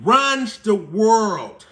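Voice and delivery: very slow, dragged out; the truth, dragged out for viewers; slow emphatic. Shown as a demonstration. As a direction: spell it out slowly. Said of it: "once again".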